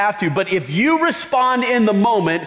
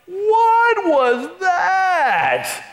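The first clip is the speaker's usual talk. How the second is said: shocked cry; 12 semitones higher, much slower than usual. Pretend. Someone scalded by an angry outburst, shocked cry. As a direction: shriek in shocked disbelief.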